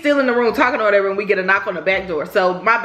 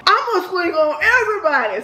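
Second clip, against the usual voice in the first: put-on high-pitched little-boy boast; imitated little boy; 10 semitones higher than usual. Little cousin boasting before the fight, high imitated boy. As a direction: talk big like a small kid.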